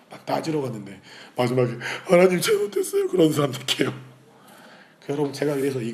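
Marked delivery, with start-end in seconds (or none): 2.1-3.9 very high, loud falsetto mock surrender; hammed-up pleading. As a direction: squeal an over-the-top apology to God. Comic sudden surrender to God. (높은 가성으로 과장되게 싹싹 비는 말투)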